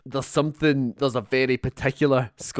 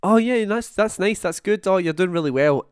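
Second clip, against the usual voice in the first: high gushing mock praise; fake teacher praise; 6 semitones higher than usual. Tutor gushing over a student's work, mock high praise. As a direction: gush like an encouraging tutor.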